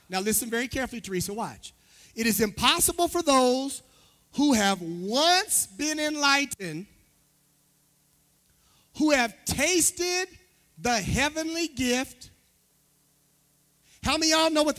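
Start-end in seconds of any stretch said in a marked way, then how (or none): 2.1-12.3 slow, raised pulpit reading with pauses; scripture reading. Acted. Pastor reads Hebrews to the church, proclaiming it. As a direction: proclaim the verse phrase by phrase.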